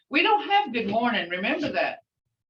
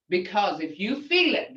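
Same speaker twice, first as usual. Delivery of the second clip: ordinary talk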